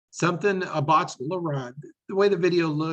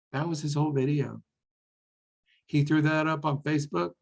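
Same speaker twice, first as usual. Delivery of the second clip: ordinary talk, nothing marked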